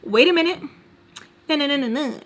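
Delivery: high-pitched inner alarm; little reminder voice. Acted. Her own brain reminding her to hold up, high-pitched. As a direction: pipe up high as a nagging thought. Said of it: "little reminders in my brain".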